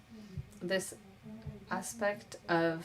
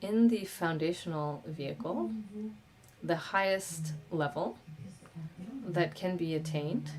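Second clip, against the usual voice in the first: ordinary talk, nothing marked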